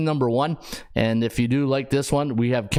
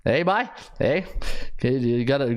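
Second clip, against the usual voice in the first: Newfoundland twang. Retold or imitated. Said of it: "you got two Newfie roommates".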